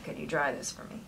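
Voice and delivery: low, soft audiobook dialogue; hushed low request; soft and airy. Acted.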